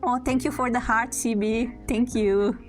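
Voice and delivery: usual speaking voice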